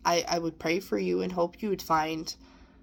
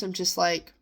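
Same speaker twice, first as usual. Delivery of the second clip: ordinary delivery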